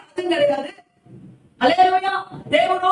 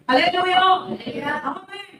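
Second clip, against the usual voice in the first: ordinary delivery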